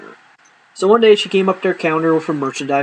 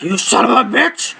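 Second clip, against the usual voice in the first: mock outrage; 9 semitones higher than usual. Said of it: "I'm like".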